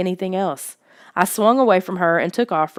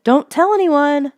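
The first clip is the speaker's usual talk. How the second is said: higher, louder, slow scream; 9 semitones higher, 6 dB louder than usual. A narrator's reading. Lissa screaming after the running boy, higher and louder. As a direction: scream it across the distance. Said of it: "Lissa screamed again".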